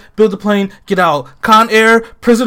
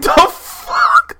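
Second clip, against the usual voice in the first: shocked exclamation; 11 semitones higher, 3 dB louder than usual. Retold or imitated. Retold shock at the news.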